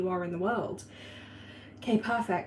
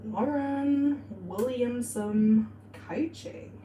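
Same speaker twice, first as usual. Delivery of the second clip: very slow, drawn-out words while writing; 3 semitones higher, much slower than usual. Real, not acted. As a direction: stretch each word as you jot it down. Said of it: "Let's write that down".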